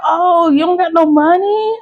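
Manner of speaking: put-on high-pitched teasing old lady; imitating old lady. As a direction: squeak it up like the old cashier. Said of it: "she's an old lady".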